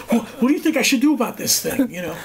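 high, lightly voiced nervous diva; a hint of a jittery singer. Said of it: "she goes".

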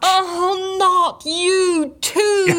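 drawn-out anguished wail; exasperated cry. Acted. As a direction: despair at the joke. Exasperated cry at second pirate.

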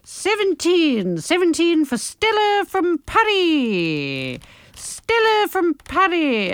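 high, slow, stretched announcement; drawn-out time-filling. Real, not acted. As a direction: draw every word out high to fill time. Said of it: "I'm just trying to fill while I flick".

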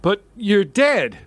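ordinary delivery

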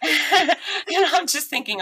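high laughing through the words, slow. Real, not acted. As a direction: crack up while saying it. Laughing at her own situation.

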